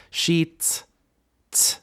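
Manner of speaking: SHIT shut off by a separate final T; closed syllable. Shown as a demonstration. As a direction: stop SHIT on a detached T.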